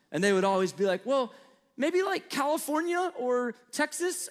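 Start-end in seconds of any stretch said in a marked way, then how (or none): 1.0-4.3 put-on high, slow guessing kid; a student's unsure guess, pitched way up; hesitant child voice; imitating a child. Kids guessing his origin.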